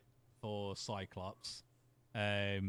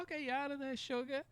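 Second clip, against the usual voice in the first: put-on Rogue Southern accent. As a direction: sweet-talk like a Southern belle. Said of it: "She's like".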